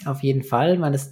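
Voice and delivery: normal talking voice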